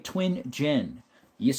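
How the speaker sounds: usual speaking voice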